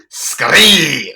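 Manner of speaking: very loud, thick Scottish shout; thick Scottish accent. Retold or imitated. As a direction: blurt it out loudly in mock Scottish. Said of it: "say it in an incredibly thick Scottish accent".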